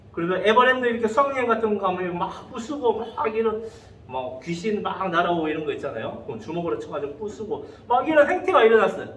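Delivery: ordinary delivery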